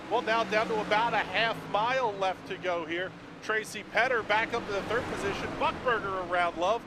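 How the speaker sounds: loud, high play-by-play finish; motorsport commentator. Acted. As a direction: call the last lap with rising excitement. Live finish call.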